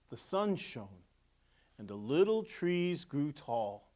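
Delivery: lively storybook narration; reading to children. A narrator's reading. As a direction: read it like a picture book to kids. Reading picture book aloud.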